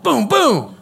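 loud, high booms for punches; punch sound effects. Retold or imitated. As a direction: punch out each boom. Acting out two sucker punches.

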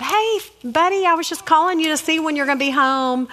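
friendly greeting. Retold or imitated. Mom phoning her son before he comes home, friendly. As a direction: open high and easy, like a casual call. Acting cheerful phone call to son.

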